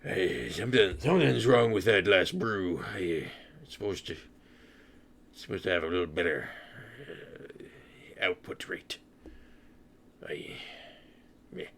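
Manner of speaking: put-on tavern worker's voice; hesitant character voice, petering out; dragonborn barkeep. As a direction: voice an awkward dragonborn at the bar. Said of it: "looks over at the sad table and goes".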